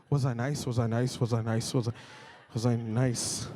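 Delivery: low, anxious repeated muttering; worried self-questioning. Retold or imitated. Pastor rewinding the encounter in his head, worried. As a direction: replay it nervously, pitch dropped low. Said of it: "rewound real quick in my head".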